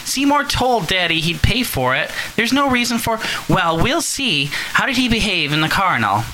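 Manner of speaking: high, fretful mother on the phone; worried mother. Acted. Mother phoning her daughter, high and anxious. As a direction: fuss anxiously over your daughter.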